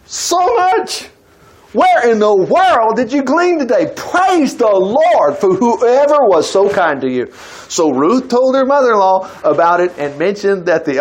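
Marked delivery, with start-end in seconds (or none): 0.1-7.3 high-pitched astonishment, then drawn-out praise; amazed Naomi. Acted. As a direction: exclaim in astonishment, higher than usual. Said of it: "now this is Naomi talking".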